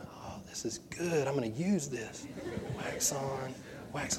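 hushed, near-whispered self-talk; very soft, secretive awe; awed kid muttering. Retold or imitated. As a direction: murmur it softly to yourself like an impressed child. Child secretly rehearsing movie moves.

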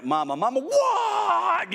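high, loud drawn-out what; mock-frazzled dad finally snapping; exasperated what. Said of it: "you know".

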